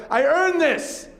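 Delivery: high, indignant entitled driver; mock a smug driver claiming his lane. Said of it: "people are like".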